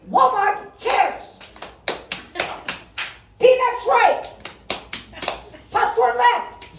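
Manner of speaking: high, slow, drawn-out cheer calls; old cheerleader greeter. Retold or imitated. Comic at the crowd, mocking a Walmart greeter, high slow cheer. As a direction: chant the aisles like an aged cheerleader. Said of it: "It looks like this".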